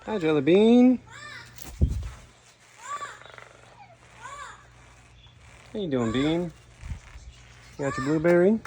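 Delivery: high sing-song coo. Real, not acted. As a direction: coo at the bird lovingly. Cooing to a bird.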